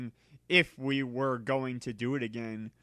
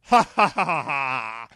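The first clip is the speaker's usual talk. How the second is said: cackling laugh; 7 dB louder than usual. A narrator's reading. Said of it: "started to cockle".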